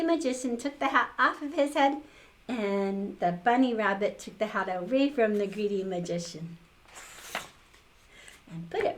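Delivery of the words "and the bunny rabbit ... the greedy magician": ordinary delivery, nothing marked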